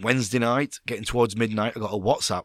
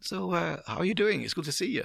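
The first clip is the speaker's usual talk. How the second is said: calm boss voice; 9 semitones higher, 4 dB quieter than usual. Retold or imitated. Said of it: "He was very calm".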